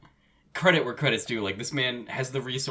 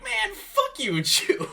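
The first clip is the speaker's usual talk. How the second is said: slow, high, laughing mock curse; laughing insult; 4 dB louder, slower than usual. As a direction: curse him out while cracking up.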